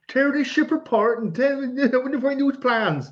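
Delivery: mock West Country Darth Vader. Quoting Prowse's undubbed Vader audio.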